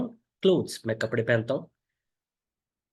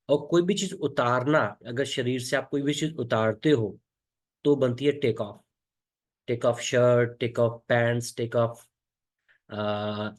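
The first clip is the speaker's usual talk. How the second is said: ordinary talk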